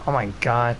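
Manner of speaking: usual speaking voice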